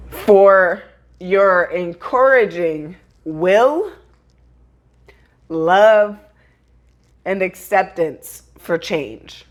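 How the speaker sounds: slow, halting, voice close to breaking; a grieving farewell read slowly with a catch in the voice; tearful reading. Real, not acted. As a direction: read it through real tears, pausing to steady yourself. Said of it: "this is why I really am gonna cry".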